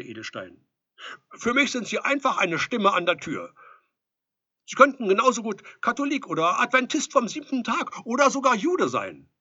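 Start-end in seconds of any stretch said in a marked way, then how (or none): 1.3-9.3 high, loud, urgent protest; loud self-defence. A narrator's reading. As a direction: justify yourself through a closed door. Flustered Edelstein defends himself.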